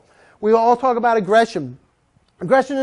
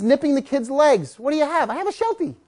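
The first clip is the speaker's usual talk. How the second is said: ordinary delivery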